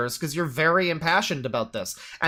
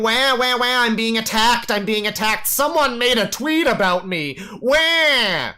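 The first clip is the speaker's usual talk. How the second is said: higher, louder mocking wail; mock crybaby whine; 5 semitones higher, 3 dB louder than usual. Streamer mocks the guy as a crybaby, in a high mocking wail. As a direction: whine like a bawling baby to belittle him. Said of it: "you just keep going".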